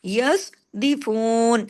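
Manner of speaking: यस्दिफून very slow with a heavy स्वाद; slow recitation; heavy swad. Shown as a demonstration. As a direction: press the स्वाद thick and full. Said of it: "स्वाद को heavy करें".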